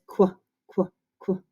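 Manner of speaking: clipped "Qu, qu, qu" repeated as a sound drill; letter sound demo. Shown as a demonstration. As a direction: tap out the sound "Qu" three times. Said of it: "Q U says".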